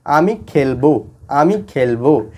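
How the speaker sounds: slow, word by word: আমি খেলবো; slow classroom demonstration; slow teaching demo. Shown as a demonstration. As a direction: spell out আমি খেলবো slowly for students. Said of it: "অর্থাৎ সে নিজে খেলবে".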